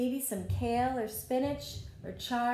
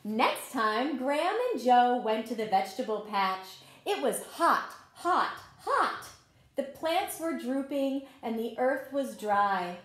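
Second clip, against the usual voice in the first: story narration; 5 dB louder than usual. A narrator's reading. Teacher reading a garden story to children, gentle read-aloud. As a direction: tell the story like a picture book at circle time. Reads picture book to children.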